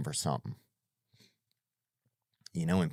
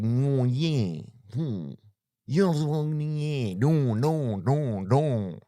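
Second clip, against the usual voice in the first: mock nasal singer; 5 semitones higher, much slower than usual. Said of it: "It sounds like Japanese, nasaled out".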